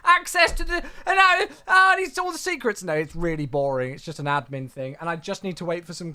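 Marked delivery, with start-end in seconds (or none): 0.0-2.8 higher and louder, gushing with a laugh; hammed-up breathless gossip voice; mock excited chatter. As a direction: play an overexcited fan imagining secrets. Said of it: "Don't get yourself like".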